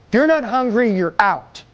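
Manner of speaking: ordinary talk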